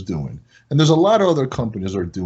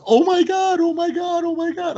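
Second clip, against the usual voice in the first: squealing oh my God. Retold or imitated. Customer recognizing the owner on the phone, very high and excited. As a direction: shriek it like a thrilled fan. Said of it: "She goes".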